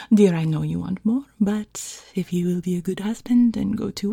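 put-on French-accented seductive housewife; playful French-accented goodbye with small chuckles. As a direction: coax teasingly in a French accent. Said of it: "I'm basically using his voice".

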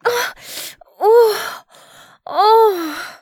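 very high, moaning disbelief; shocked gasping. Acted. Woman reacts to her partner, shocked and breathless. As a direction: gasp the words out in a high voice.

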